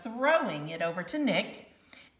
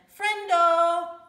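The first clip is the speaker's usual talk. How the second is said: loud, high, gleeful call; group shout; 9 semitones higher, 10 dB louder than usual. A narrator's reading. Kids posing for class picture, loud gleeful shout. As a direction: shout it out for the camera.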